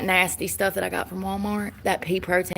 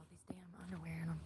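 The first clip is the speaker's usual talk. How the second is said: fast hushed grumble; whispering; 4 semitones lower, 19 dB quieter, faster than usual. Real, not acted. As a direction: grumble it under your breath.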